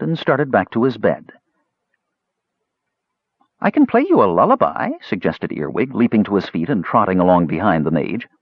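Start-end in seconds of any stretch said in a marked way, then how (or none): 3.6-4.9 high, eager offer; eager kender. Acted. Kender trots after the mage, high eager offer. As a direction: pipe up eagerly.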